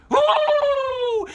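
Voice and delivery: put-on high, loud shriek of a possessed girl; mock possessed shriek. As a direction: let out a sudden wild shriek. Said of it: "they twitched and they trembled".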